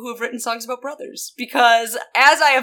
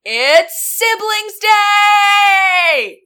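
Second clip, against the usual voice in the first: much higher, slow, louder proclamation; hammed-up excited shout; excited announcement; 9 semitones higher, 7 dB louder, much slower than usual. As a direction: announce it like a big reveal. Over-the-top announcement.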